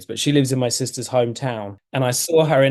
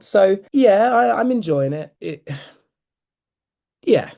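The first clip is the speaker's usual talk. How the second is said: hesitant, higher-pitched half-endorsement ending in a sigh; trailing-off lukewarm; 7 semitones higher, 4 dB louder than usual. Real, not acted. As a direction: say it with unspoken reservations, sighing away. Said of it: "dot, dot, dot".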